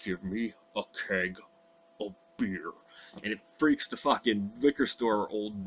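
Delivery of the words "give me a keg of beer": menacing wolf. Retold or imitated. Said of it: "and he goes".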